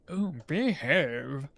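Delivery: lightly voiced, slow Austin Powers; Austin Powers impression. Gamer asks how he says it, then slow mock Austin Powers. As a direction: drawl it out like a half-hearted Austin Powers. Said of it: "How does he say it?".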